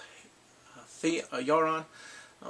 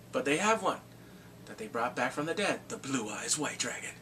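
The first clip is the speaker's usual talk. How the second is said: usual speaking voice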